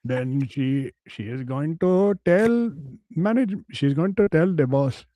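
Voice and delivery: mock Indian accent, slow in places; man boasting of his fantasy in a mock Indian accent.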